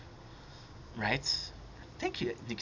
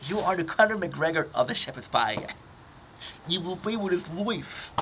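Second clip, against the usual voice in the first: raised, hammed-up hype voice; mock fight hype; 5 semitones higher than usual. Conor McGregor comparison, mock hype.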